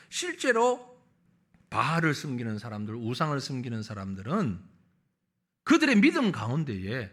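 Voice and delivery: ordinary delivery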